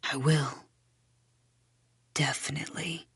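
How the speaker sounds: low, hushed promise; very soft, low whisper. A narrator's reading. Seth assuring Belle, whispered. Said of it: "he whispers".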